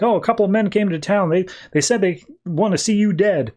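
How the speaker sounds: a jittery little bookworm bringing bad news; Wheeler voice. Goofy writer warns the fake Jonah of gunmen, high nervous voice. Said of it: "goofy dude in glasses whose name is Wheeler".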